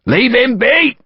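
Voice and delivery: high, urgent shouted order; sergeant's shout. A narrator's reading. Sergeant to his men over a fallen soldier, shouting. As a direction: shout it across the ranks. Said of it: "a sergeant shouted".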